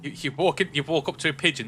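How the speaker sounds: ordinary talk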